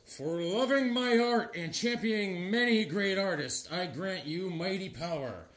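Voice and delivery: slow, soft apparition bestowing powers; mock-mystical spirit voice; Neil Adams. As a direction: intone a solemn blessing, hushed and drawn out. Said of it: "an apparition comes".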